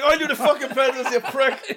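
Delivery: mock angry retort. Driver snapping back at passenger, mock angry. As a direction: play a fed-up driver, half laughing. Acts out arguing in the car.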